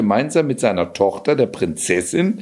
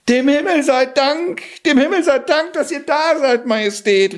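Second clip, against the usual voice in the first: much higher, feigned panicked relief; frantic cry. Acted. Cat stops the king's coach, much higher feigned panic. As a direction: play the desperate rescue plea. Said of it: "rief der Kater".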